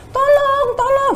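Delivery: high shrill cry of tolong; desperate cry for help, retold; retold scream for help. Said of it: "kita semua teriak".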